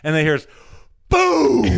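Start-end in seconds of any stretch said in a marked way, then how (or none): 1.1-1.6 loud, high mimicked cannon blast; cannon boom. Teller mimics the cannon onstage, booming.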